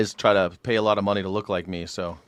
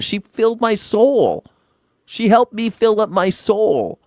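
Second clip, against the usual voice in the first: mock heartfelt; 8 semitones higher, slower than usual. Mock heartfelt about Anne.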